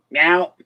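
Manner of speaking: a short playful meow that rises at the end; mock meow. Owner voicing his cat being sued, with a playful rising meow. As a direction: answer for the cat with a cute little meow.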